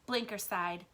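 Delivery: ordinary delivery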